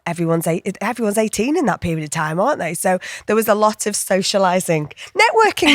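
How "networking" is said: wry joke. Real, not acted. Wry joke about socialising.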